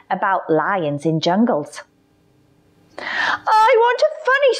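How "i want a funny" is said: high, pleading lion voice; whiny lion. A narrator's reading. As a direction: wheedle like a child. Lion asks whining.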